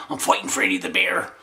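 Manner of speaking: ordinary talk, nothing marked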